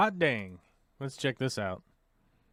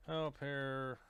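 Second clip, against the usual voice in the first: very soft, slow, stretched words; 13 dB quieter, much slower than usual. Real, not acted. Streamer typing a question about Danish, soft and drawn out. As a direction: murmur it piece by piece as you type. Speaking a search while typing.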